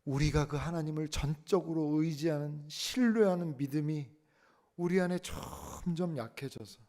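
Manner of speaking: high, loud, fervent preaching; full-force preaching. Acted. Pastor urging the congregation to trust God, loud preaching.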